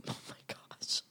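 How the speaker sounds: whispered through a laugh; breathy whisper. Real, not acted. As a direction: gasp it out under your breath.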